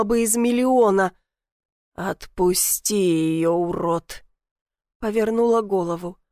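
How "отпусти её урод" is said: ниже, медленно, холодно; спокойная угроза; низкий спокойный голос. A narrator's reading. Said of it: "Низкий, спокойный, с той самой хрипотцой". (низкий голос с хрипотцой)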